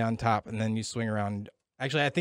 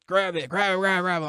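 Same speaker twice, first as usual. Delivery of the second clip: higher-pitched mock urging to grab on; urgent command; 7 semitones higher, 3 dB louder than usual. Drill instructors swinging the rope at a scared recruit, urgent. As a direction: imitate an instructor's insistent, repeated order.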